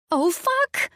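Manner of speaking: high-pitched startled shriek; panicked shriek. A narrator's reading. Diner panicking at the table, shrieking. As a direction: cry out high in sudden panic. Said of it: "I shrieked".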